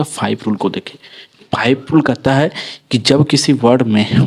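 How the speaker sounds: ordinary talk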